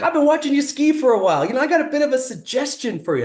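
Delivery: very high, chirpy impersonation; breezy, high-pitched mock cheeriness; chipper and cheery. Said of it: "He's all chipper and cheery".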